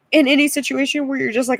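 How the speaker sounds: usual speaking voice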